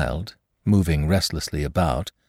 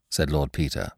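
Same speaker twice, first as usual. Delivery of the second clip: ordinary talk, nothing marked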